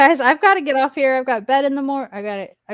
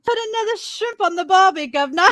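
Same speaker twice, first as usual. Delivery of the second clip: higher-pitched mock Australian; Australian accent; 5 semitones higher than usual. Shown as a demonstration. Trying to do it like friend, mock Australian accent. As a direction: put on a playful Australian accent. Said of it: "could do it like you".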